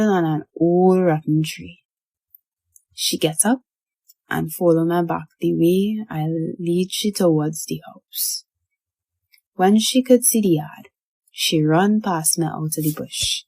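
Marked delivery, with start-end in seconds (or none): none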